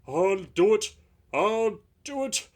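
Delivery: high, loud gasping effort. Acted. Straining engine gasping as he crawls in. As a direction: force it out between gasps. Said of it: "he gasped between the clanks".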